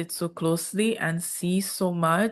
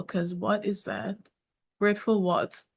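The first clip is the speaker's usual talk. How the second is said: usual speaking voice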